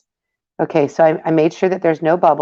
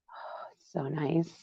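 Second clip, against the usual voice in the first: admiring whisper; 17 dB quieter than usual. Real, not acted. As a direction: breathe it out softly. Admiring the paint.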